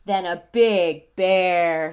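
slow, loud and big; big booming voice. Acted. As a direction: make the bear sound huge. Big bear narration.

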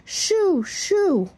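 very high shooing cry, falling; hollered shoo. A narrator's reading. Grandma shooing birds off the pie, high hollering. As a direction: flap them away with your voice. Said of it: "hollered Grandma".

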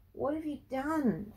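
high, drawn-out plea; pleading. A narrator's reading. Frankie demands answers from granddad, pleading. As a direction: beg him for the truth. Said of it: "Frankie pleaded".

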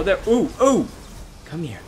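ordinary delivery

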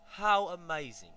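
storyteller's wide-eyed wonder; amazed. A narrator's reading. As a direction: marvel at it aloud, drawn out. Narrator marvels at giant duck.

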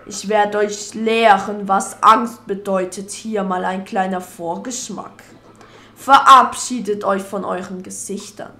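loud, slow, menacing villain speech; evil dark lord. Acted.

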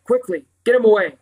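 urgent. A narrator's reading. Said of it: "A girl's voice said urgently".

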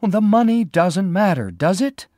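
high, loud, distressed outburst; crying unhappily. Acted. Lila begging the sheriff to search, distressed high cry. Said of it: "Lila cried unhappily".

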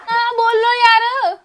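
loud, high, drawn-out wail of frustration; exasperated cry. Real, not acted. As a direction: beg loudly, dragging out every word. Begs silent partner to speak.

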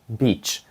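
"Beach" with a long soft ee like Ukrainian І. Shown as a demonstration. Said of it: "Як українська І".

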